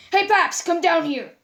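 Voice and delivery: loud, high call; calling upstairs. Acted. Sans calling Papyrus down to meet the gems, loud call. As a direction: call up to your brother.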